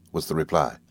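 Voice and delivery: plain reading voice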